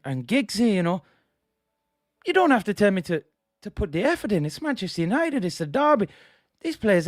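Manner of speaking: exasperated pundit in a put-on Dublin Irish accent; Roy Keane impression. Streamer mimics Keane scolding United players over the derby, Irish-accented indignation. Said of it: "come back as Keno".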